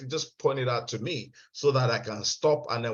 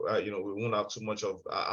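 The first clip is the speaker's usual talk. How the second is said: ordinary delivery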